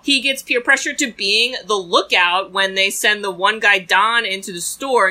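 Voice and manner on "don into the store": normal talking voice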